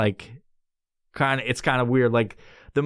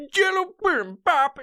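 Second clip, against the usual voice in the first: squeaky high. Acted. Playful high voice on the name.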